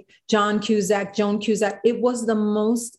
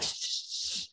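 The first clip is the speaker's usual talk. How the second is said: shushing; 15 dB quieter than usual. Retold or imitated. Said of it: "everyone's like".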